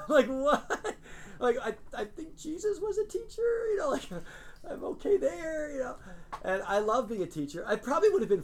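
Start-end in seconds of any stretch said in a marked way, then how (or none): 0.1-5.9 laughing in disbelief. Real, not acted. Laughs at his dad's reaction.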